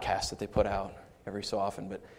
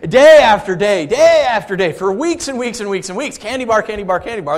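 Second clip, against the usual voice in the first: louder, higher, building sing-song; excited repetition; 8 semitones higher, 11 dB louder than usual. Acted. Pastor telling congregation of daily candy, excited sing-song. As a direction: rattle it off like a gleeful chant. Mocking endless candy routine.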